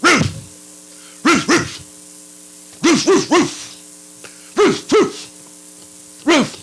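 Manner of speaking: high repeated dog barks; barking all the way. Acted. Son grumbling all the way to the field, high barking. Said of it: "the one was like".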